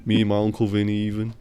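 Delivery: put-on deadpan Jersey mobster accent; straight-faced New Jersey wiseguy. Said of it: "we do the Jersey accent".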